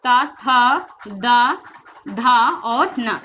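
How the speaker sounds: ता, था, दा, धा slow with long vowels; articulation demo. Shown as a demonstration. Teacher showing the dental sounds, ता, था, दा, धा drawn out. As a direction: hold ता, था, दा, धा evenly, finish with और ना. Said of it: "इसका जो उच्चारण स्थान होता है".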